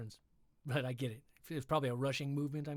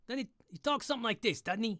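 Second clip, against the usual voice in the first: fast, higher raccoon-gangster rasp; Rocket voice; 7 semitones higher, faster than usual. Shown as a demonstration. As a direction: try out a Rocket voice. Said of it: "kinda like Rocket".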